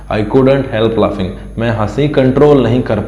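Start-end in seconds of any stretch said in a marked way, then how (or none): none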